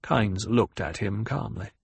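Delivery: ordinary delivery, nothing marked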